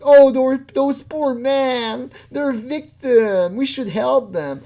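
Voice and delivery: high, loud, drawn-out mock sympathy; mock pity. Mocking people who pity predators as victims, hammed-up sympathy. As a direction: coo exaggerated pity, slowly.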